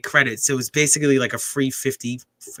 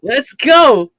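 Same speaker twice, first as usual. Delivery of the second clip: yelling from the sidelines; 10 semitones higher, 8 dB louder than usual. Real, not acted. Cheering a fight live.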